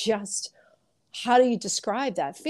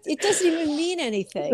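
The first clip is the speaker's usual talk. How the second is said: exasperated mock outburst; 7 semitones higher than usual. Ranting about quotes nobody reads, exasperated. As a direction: burst out in fed-up disbelief. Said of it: "I'm like".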